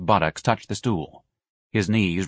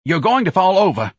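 loud, high, impatient tenor; snapping warning; 11 semitones higher, 6 dB louder than usual. A narrator's reading. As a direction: warn her sharply. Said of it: "snapped at her".

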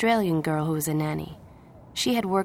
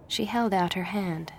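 plain reading voice

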